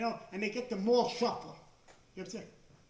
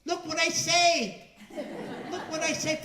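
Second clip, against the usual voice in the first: higher, louder, slow mock gloat; wife boasting; 7 semitones higher, 7 dB louder than usual. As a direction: imitate the wife showing off her bargains. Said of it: "she's saying".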